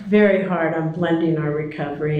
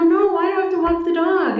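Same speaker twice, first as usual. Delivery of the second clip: high exasperated question; 9 semitones higher than usual. Retold or imitated. Pressing Bobby for answer.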